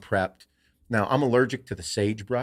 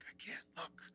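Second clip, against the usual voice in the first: tiny urgent whisper, retold; whispered reply; 8 semitones higher, 24 dB quieter than usual. Hunter frozen under the cow's stare, whispering back. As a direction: whisper it without moving a muscle.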